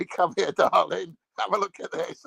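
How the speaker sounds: prankster calls darling over, playfully coaxing; calling partner over. Acted. Man luring his partner to the bathroom, mock-sweet.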